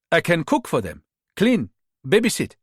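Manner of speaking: job-seeker with a Ghanaian lilt; Ghanaian accent. Acted. Rita posing as a maid to the mother, Ghanaian accent. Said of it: "staying with the Ghanaian accent".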